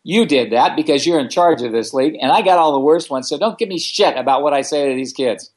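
snapping back at the league organizer; angry retort. Retold or imitated. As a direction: put the lady in her place.

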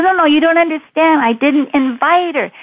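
high, flustered mock protest; upset, acted out. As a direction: plead your case as the retold frantic caller. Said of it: "he was just so upset".

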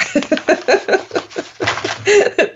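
long high giggle; helpless amused laughter. Real, not acted. Amused by the phrases.

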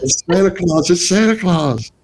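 put-on high excited kid's cry; excited child. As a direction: squeal it like a thrilled little kid spotting Santa. Kids spotting Santa, calling out.

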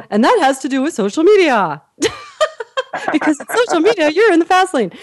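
high-pitched, laughing punchline delivery; hammed-up segue. Shown as a demonstration. Storyteller linking her anecdote to social media, hammed up. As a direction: sell the silly link, cracking up.

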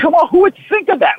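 who would think of that, said with a high amazed lift. Real, not acted. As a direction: pitch leaps up in disbelief. Amazed at coach's woodworking idea.

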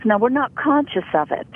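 usual speaking voice

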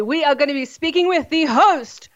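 normal talking voice

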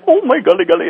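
high-pitched imitation of an Indian accent. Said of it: "he was Indian".

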